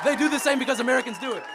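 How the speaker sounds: high-pitched protest. Real, not acted. Debater cutting past the opponent's thank you, high insistent protest.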